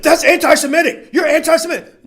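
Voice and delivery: high, loud hammed-up accusation; mock outrage. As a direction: imitate a shrill-pitched, outraged accuser. Mimics accusers crying anti-Semitism.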